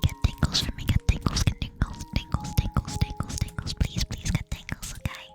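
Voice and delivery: close-mic whispered ASMR, soft and tender. Acted. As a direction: murmur tingles right into the mic.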